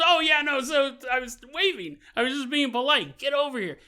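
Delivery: flustered crewman. Retold or imitated. Waver caught, gets dragged away.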